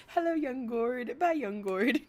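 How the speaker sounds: playful imitation on the edge of laughter; mock greeting. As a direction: mimic a brisk hello and goodbye. Imitating someone's hello and quick goodbye.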